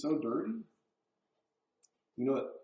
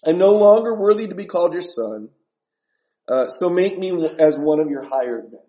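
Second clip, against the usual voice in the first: loud, raised pleading to the father; loud confession; 3 semitones higher, 10 dB louder than usual. Retold or imitated. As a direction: keep begging out loud like the returning son. Son's rehearsed confession continued.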